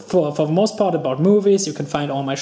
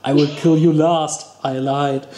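Arnold Schwarzenegger impression; 2 semitones lower than usual. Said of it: "Commando was good".

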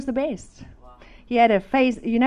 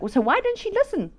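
high-pitched comic puzzlement; mock incredulous question; 10 semitones higher than usual. Husband wondering why she didn't listen, high incredulity. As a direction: ask it high, as if obvious. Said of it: "And he's like".

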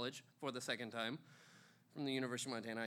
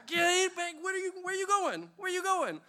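high, loud panicked calling; alarmed yell; 7 dB louder than usual. Retold or imitated. Grabbing the girlfriend at the car door, yelling in panic. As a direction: call out as she opens the door.

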